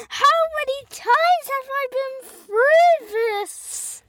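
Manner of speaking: very high, slow, wailing complaint; exasperated worm voice. Acted. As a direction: wail it high like a fed-up worm. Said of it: "And then he's like".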